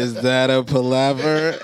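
high, loud, drawn-out mock question; hammed-up shout. Host joking with the new word, mock shouting. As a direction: holler it as an over-the-top example. Joke example after laughter.

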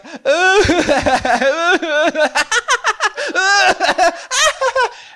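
loud, high crying laughter; exaggerated laughing-sobbing; imitated joy laughter. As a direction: howl with hysterical mock joy. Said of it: "the joy started to turn to laughter".

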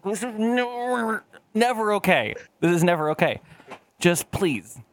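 high, slow pleading with never stressed. Retold or imitated. Curly pleading with his boss, stressing never. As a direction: beg with weight on never. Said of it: "And I'm like".

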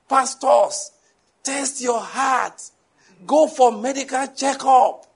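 high, loud, drawn-out preaching cry; imitated zealous preacher. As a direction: mimic an overzealous man urging pastors. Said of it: "talking with a lot of zeal".

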